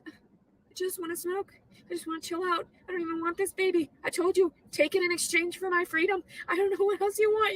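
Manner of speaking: ordinary talk, nothing marked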